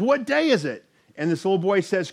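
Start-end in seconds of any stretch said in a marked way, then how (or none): 0.0-0.8 high call without much volume; mock yell. Scrooge running to the window, yelling out. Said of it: "he yells out".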